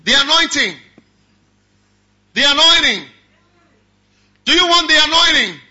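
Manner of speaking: high, loud preacher's call. Real, not acted. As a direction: ring it out to the crowd. Preacher calling out anointing.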